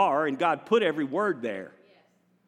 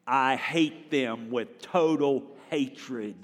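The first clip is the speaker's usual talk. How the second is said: slow reading; 5 semitones lower, much slower than usual. Shown as a demonstration. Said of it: "Let's read verse twenty-two together".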